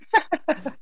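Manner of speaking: high squealing laugh. Real, not acted. Laughing at own joke.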